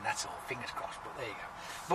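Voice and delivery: soft, almost whispered hope; quiet aside. Real, not acted. Vlogger hoping for another Skype call, hushed murmur.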